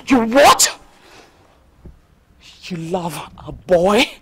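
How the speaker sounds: ordinary talk, nothing marked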